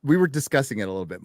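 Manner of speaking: ordinary delivery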